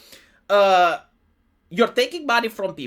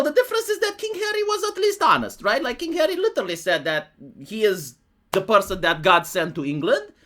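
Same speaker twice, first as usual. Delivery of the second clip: ordinary delivery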